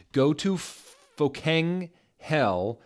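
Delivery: slow, drawn-out mock fury; mock angry author. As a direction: spit it in a hammed-up teen rage. Reading the author's angry note.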